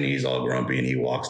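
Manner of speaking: put-on low, grumbling old man; grumpy old man voice. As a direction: growl it like a cranky grandpa. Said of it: "pitching these stories, trying to do these, like, bullcrappy voices".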